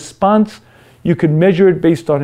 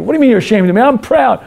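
defiant outburst; 6 semitones higher, much faster than usual. Acted. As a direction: shout it off, defensive and annoyed. Self proud.

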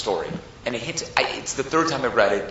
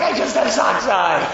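mock plea; 8 semitones higher, 4 dB louder than usual. Chased brother pleads, acted.

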